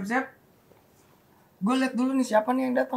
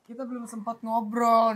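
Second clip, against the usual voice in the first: hushed retold heart-to-heart; subdued plea; 7 dB quieter, slower than usual. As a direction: imitate a soft, slow appeal. Re-enacts quiet heart-to-heart.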